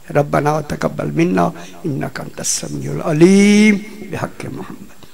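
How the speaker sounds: low, steady supplication; prayer. Acted. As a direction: recite the dua humbly. Leads closing dua. (धीमी आवाज़ में दुआ)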